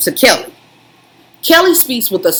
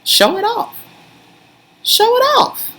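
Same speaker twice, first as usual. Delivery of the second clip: teasing hype. Real, not acted. Teasing partner to show off.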